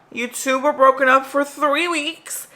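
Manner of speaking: high, loud, melodramatic confession; Cassie from Euphoria. Acted. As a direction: play the overwrought TV drama heroine at high pitch. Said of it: "is that Cassie from Euphoria?".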